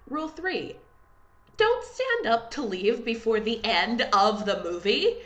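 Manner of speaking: ordinary delivery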